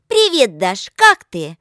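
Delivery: loud, high phone hello; bright greeting. A narrator's reading. Lika answering Dasha's call, loud eager greeting. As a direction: greet a friend loudly, drawn out.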